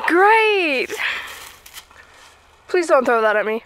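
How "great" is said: high silly squeal. Pretend. Repeating word different ways.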